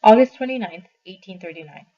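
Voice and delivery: loud heading read; reading the date heading aloud; announced date. Acted. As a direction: call out the letter date.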